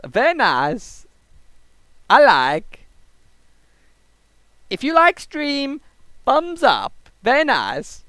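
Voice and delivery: broken-English Borat impression; Borat accent. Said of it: "why I do that for Borat".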